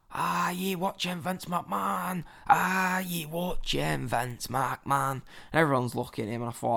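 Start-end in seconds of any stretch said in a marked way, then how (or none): 0.1-5.2 put-on slow, drawn-out drunken Scottish chant; drunk Scottish guy. Drunk Scottish fan trying to start a chant, slow Scottish accent. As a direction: imitate a drunk Scot struggling to start a chant, stretched out. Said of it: "Drunk Scottish guy next to me is trying miserably".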